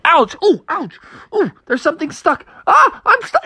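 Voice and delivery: high yelps of ouch and alarm; mock panic as the hook bites; hooked Froggy in pain. Froggy caught on dad's hook, high pained yelps. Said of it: "all of a sudden he's like".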